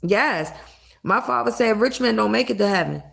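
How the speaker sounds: ordinary talk, nothing marked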